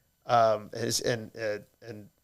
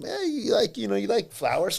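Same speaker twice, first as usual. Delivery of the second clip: higher chirpy salesman pitch; 8 semitones higher than usual. Retold or imitated. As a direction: sell it with a bright pushy lilt. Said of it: "that's like a sales technique".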